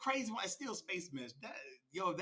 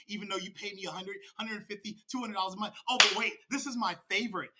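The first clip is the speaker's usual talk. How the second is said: normal talking voice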